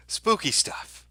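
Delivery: hammed-up spooky falsetto; mock spooky voice. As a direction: ham it up like a ghost story. High put-on spooky voice.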